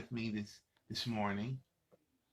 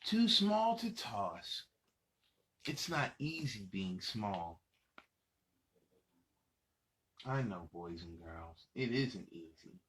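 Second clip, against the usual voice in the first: put-on lively read-aloud for little kids; reading to children; 3 semitones higher, slower than usual. Adult reading a picture book to young children, lively storytelling. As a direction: read it out bright and animated for the kids.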